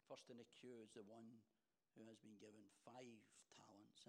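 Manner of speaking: ordinary talk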